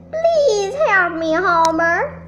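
loud pleading wail; howling. A narrator's reading. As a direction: howl for help. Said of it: "Hannah howled".

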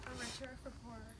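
soft, choked-up vow; emotional vow repeat. Real, not acted. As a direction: hold back tears, softly. Bride repeats vows softly.

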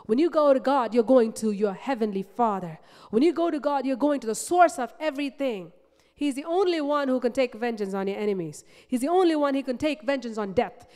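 rally the congregation with rising fervour; fervent preaching. Real, not acted. Preacher builds to full force.

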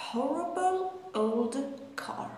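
each word drawn out, very slow; very slow repeat. Shown as a demonstration. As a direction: stretch each word for learners. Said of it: "model sentence repeated". (تکرار خیلی آهسته)

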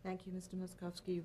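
ordinary talk

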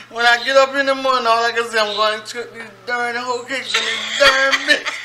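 talking through helpless laughter; high, giggling words between fits; laughing fit. Real, not acted. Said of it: "Is it really that funny".

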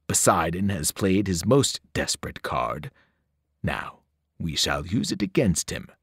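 calm a servant while plotting; menacing titan. Acted. Monster lays out the plan.

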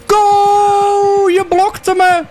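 high, drawn-out outraged scream; high-pitched protesting yell; shouting at the game. Real, not acted. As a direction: scream the first word long, then yell the complaint. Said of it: "Lotte vraagt of je niet zoveel schreeuwt".

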